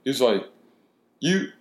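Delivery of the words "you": high mocking voice for the confronting guy; mock tough guy. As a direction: imitate the challenger's disbelief, much higher. Fat guy confronts him.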